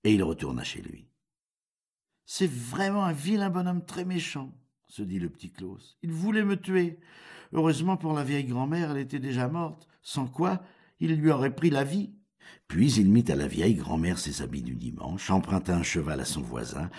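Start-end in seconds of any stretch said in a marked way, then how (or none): 2.2-4.5 high, light character voice; shaken Petit Klaus. A narrator's reading. Would-be victim remarking to himself on his attacker, high-voiced. 6.0-12.1 high-pitched little-man voice; shaken Petit Klaus. A narrator's reading. Would-be victim musing on his narrow escape, high-voiced.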